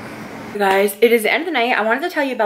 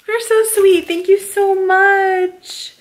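gushing thanks; 9 semitones higher, 3 dB louder, slower than usual. Real, not acted. Thanking the gift sender.

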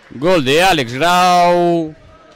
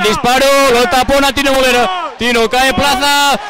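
final whistle call; 7 semitones higher than usual. Real, not acted. Final whistle, victory called.

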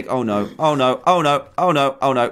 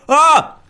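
sudden high, loud scream; startled scream; 12 semitones higher, 7 dB louder than usual. Real, not acted. Gamer startled by a zombie, screaming. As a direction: yelp in fright.

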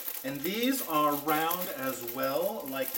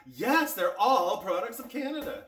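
emphatic; 7 semitones higher, 6 dB louder than usual. Real, not acted. Answers own question emphatically.

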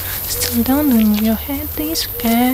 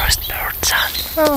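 whispering; 4 semitones higher, slower than usual. Acted. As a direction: breathe the words out slowly. Whispered reply.

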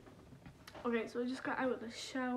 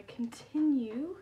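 odd way of talking; 4 semitones higher, 6 dB louder, much slower than usual. Real, not acted. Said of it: "Why do I talk like this".

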